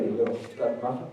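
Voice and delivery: soft, low and teasing; tender teasing. Retold or imitated. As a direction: coo it at yourself fondly. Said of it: "and I look in the mirror, and I say".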